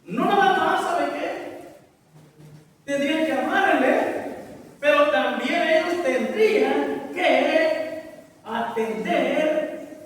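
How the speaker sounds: forceful preacher, stretching key words; impassioned preaching. Real, not acted. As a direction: preach it out with insistent emphasis. (predicación enérgica y apasionada)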